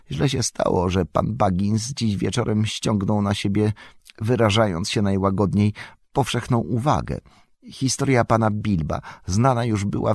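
garrulous landlord voicing his misgivings; fretful innkeeper. A narrator's reading. Innkeeper fears word of Baggins will spread, fretful.

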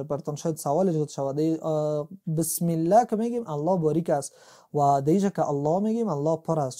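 ordinary talk, nothing marked

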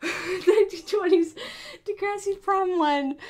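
loud, high, eager call; excited plea. Real, not acted. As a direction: blurt it out eagerly. Excited request shouted.

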